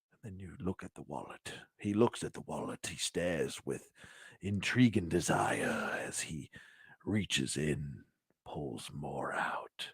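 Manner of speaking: soft, low storybook narration; hushed storyteller. Pretend. Toy host spinning a tale of wallets, hushed storyteller. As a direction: tell it like a solemn tale, hushed.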